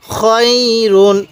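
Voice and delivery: খয়রুন with the "khoy" glide held long; drawn-out recitation. Shown as a demonstration. Teacher modelling the word, খয়রুন slow and drawn out. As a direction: stretch খয় slowly, then close on রুন. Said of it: "এরকমভাবে শিখব".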